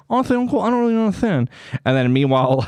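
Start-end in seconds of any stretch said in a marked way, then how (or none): 0.1-1.5 high-pitched comic nephew; high, hammed-up puzzled kid-nephew; confused nephew. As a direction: play a baffled nephew in a high voice. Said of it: "Ace is like".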